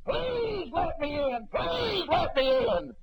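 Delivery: high, slow, shouted begging; desperate pleading. Retold or imitated. The damned crowd beg to be let in, shouting slowly. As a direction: wail it out like a lost soul at the door. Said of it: "begging and pleading".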